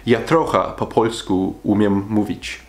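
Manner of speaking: slow, careful attempt at Polish; Polish: "Ja trochę po polsku umiem mówić" said slowly, word by word; carefully sounded-out Polish. Shown as a demonstration. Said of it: "I do not know if I said that correctly".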